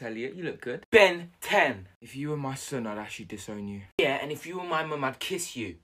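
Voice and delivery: ordinary delivery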